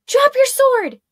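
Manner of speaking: high, loud command; a sharp shouted spell. Acted. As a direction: bark the order across the clearing. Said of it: "she shouted".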